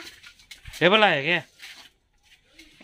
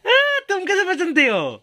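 delighted amazement; 11 semitones higher than usual. Real, not acted. Father to toddler who picked the right box, delighted amazement.

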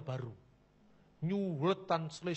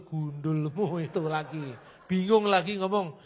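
laughing; 3 semitones lower, 6 dB louder, slower than usual. Real, not acted. Preacher laughs at confused opponent.

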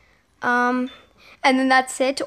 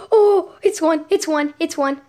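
excited; 4 semitones higher, 4 dB louder than usual. Real, not acted. The neon pet is made.

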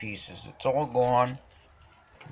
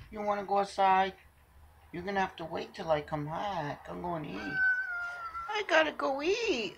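high cooing pet voice; 9 semitones higher than usual. Real, not acted. Owner baby-talking to her cat.

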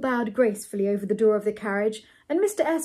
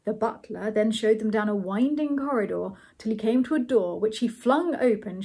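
plain reading voice

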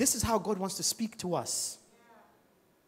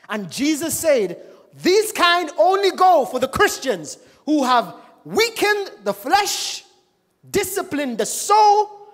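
loud, high, slow, hammering out every phrase; preaching at full force; 7 semitones higher, 9 dB louder than usual. Acted. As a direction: thunder it from the pulpit. Preaching the key point.

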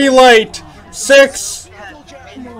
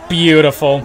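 normal talking voice

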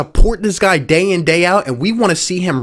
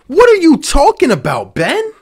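exasperated; 8 semitones higher than usual. Real, not acted. Ranting at player.

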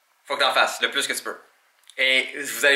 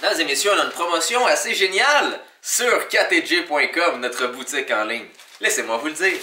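ad announcer; 4 semitones higher than usual. Pretend. Mock ad for online shop.

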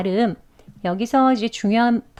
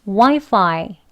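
와이파이 with WAI punched louder; stress on first syllable; 5 dB louder, slower than usual. Shown as a demonstration. Teacher modelling 와이파이 for students, first syllable stressed. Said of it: "두 번 하겠습니다".

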